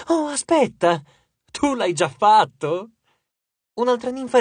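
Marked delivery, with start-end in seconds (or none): 0.1-2.9 high mock realisation, then drawn out; taunting, slow punchline; mocking afterthought. A narrator's reading. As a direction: feign a sudden discovery to needle a rival. Leo mocks Narcissus with fake realisation.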